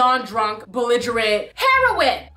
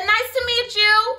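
sassy greeting; 7 semitones higher, slower than usual. Retold or imitated. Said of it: "I said".

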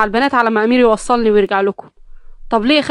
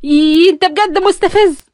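slow, high, loud huffy whine; stamping frustration; 7 semitones higher, 5 dB louder, slower than usual. A narrator's reading. Nagham stamping her foot at Omar, slow high huffy whine. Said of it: "دبت برجلها في الارض بغيظ".

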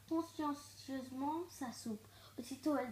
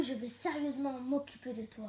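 usual speaking voice

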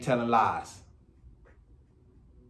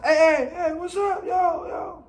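very high mock catcalling; high-pitched call across the street; shout-out catcall; 5 dB louder, slower than usual. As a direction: imitate a guy hollering at a woman. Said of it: "them little shout out calls".

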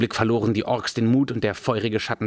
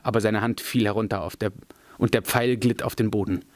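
ordinary delivery

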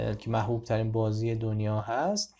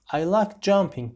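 louder model; 6 semitones higher, 5 dB louder, slower than usual. Shown as a demonstration. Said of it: "first take, louder".

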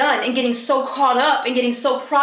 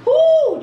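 a high, loud whoo of delight; excited whoo; 8 dB louder than usual. Real, not acted. Excited whoo on smelling her new perfume. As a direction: yelp with joy at the smell.

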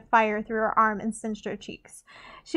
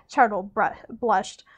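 plain reading voice

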